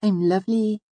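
very high, firm little voice; Auri. A narrator's reading. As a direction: state it firmly in a dainty high voice. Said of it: "she said firmly".

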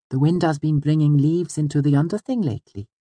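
put-on high, light girlish storybook voice; high-pitched, easy small talk; conversational Auri. A narrator's reading. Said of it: "Auri said conversationally".

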